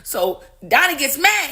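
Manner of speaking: ordinary talk, nothing marked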